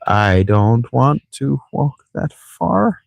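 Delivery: slow, drawn-out grumble; whiny, reluctant slow complaint; moaning complaint. Real, not acted. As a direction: drag each word out in protest. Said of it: "My pissing and moaning".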